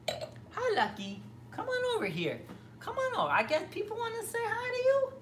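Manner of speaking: very high-pitched pet-talk voice; talking to a dog. Real, not acted. Streamer calling the dog Lucky over to greet viewers, high pet-talk. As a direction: coax a dog over in a high sing-song.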